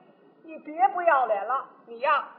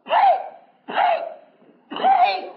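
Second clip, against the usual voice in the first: contemptuous spitting; 14 semitones higher, 6 dB louder, slower than usual. Acted. Spits contempt at the schemers.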